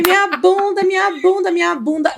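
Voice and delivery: high-pitched panicky repeated cry; mock panicked yell. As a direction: replay the panic, a little hammed. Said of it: "Aí eu comecei a gritar". (grito de pânico meio encenado)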